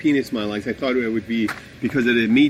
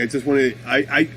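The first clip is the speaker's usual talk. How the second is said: ordinary talk, nothing marked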